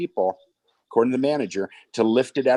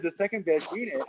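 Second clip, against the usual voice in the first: high and softer, teasing disbelief; mock-skeptical; 6 semitones higher, 5 dB quieter than usual. [name] teasing the buyer on the phone, higher, softer skeptical echo. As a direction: echo his words back, unconvinced. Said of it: "I said, I said".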